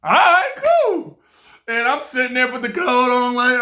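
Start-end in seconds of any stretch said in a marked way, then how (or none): 0.0-1.2 high, loud, gleeful all right, cool; thrilled agreement. Retold or imitated. Young guy squealing yes to a hustler, thrilled and high. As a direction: squeal the yes like a kid who just got rich.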